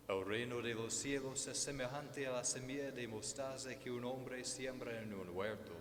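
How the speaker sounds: usual speaking voice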